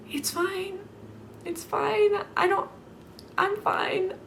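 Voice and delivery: high, tight, clearly-not-fine voice; high strained "fine". Pretend. A reader insisting she's fine in a high squeak. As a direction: squeak out "fine" like it's all okay. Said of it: "I'm actually not fine".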